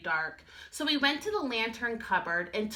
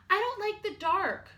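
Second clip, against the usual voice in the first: higher small cub voice; a tiny scared cub, pitched up; little bear cub; 10 semitones higher than usual. Acted. Little Bear tells Big Bear he is scared, high small voice.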